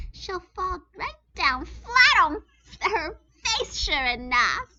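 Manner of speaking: high, loud gleeful squeal and gloat; gloating girl bird. A narrator's reading. Gertrude gloating over three feathers, high loud squeals. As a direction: shriek with greedy glee. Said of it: "Gertrude yelled".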